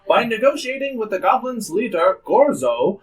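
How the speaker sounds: put-on grand old ballroom announcer; old-timey announcer. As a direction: boom it like vintage radio host. GM continues the news broadcast.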